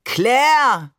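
high, loud melodramatic cry; hammed-up, dramatic exclamation; theatrical call. A narrator's reading. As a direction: call after someone like a stage lover. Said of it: "rief Barbe theatralisch".

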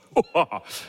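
big rolling laugh from the belly; a police chief roaring at the joke; booming belly laugh. A narrator's reading. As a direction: let a hearty laugh burst out. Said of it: "dröhnende, tiefe, bauchige Lachen".

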